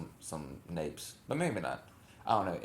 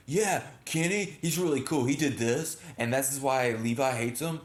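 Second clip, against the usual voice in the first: imitating fans; 4 semitones higher, 3 dB louder than usual. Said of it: "People are gonna be like".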